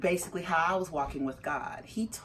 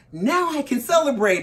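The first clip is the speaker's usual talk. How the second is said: louder, higher celebration; jubilant declaration; 7 semitones higher, 8 dB louder than usual. Retold or imitated. Husband texting after work, jubilant. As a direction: proclaim it with comic relief. Husband's delayed joy, imitated.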